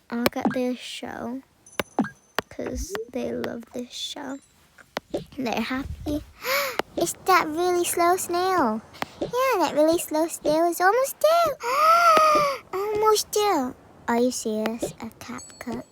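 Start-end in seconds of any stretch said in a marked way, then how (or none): 6.3-13.7 high-pitched excitement with loud gasps; pretend thrilled child. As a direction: gasp and squeal at the show. Pretend children thrilled by their show.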